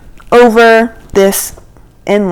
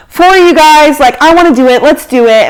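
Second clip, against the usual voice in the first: higher, louder mock resolve; rallying agreement; 4 semitones higher, 4 dB louder than usual. Reluctant girl gives in for the group, rallying. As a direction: talk yourself into it with a push.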